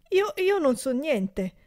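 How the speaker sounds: high, slow, stammering denial; frightened stammer of a caught servant; stammering fear. A narrator's reading. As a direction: stutter it out, scared. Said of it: "balbettò il berretta".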